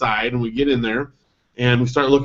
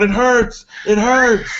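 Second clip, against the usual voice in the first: put-on high, pinched child's wail, a bit louder; mock child yelling; 10 semitones higher, 3 dB louder, much slower than usual. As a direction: wail it like the upset daughter. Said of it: "she starts yelling out".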